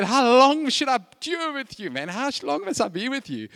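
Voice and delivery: high, exasperated appeal; acted outburst of worn-out patience; Jesus exasperated. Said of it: "that's what Jesus actually said".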